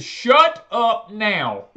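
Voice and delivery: loud stern order. Retold or imitated. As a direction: hammer each word to shut him up. Yelling at phone rep.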